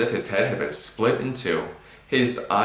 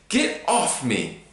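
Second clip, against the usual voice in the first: gasping; 5 semitones higher, slower than usual. Acted. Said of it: "Harry gasped".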